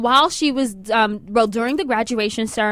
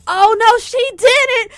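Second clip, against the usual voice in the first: high, loud imitated disbelief; mock shocked outrage; 10 semitones higher, 4 dB louder, slower than usual. Crowd reacting to the principal's remark, high mock outrage. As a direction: pitch it way up, scandalized.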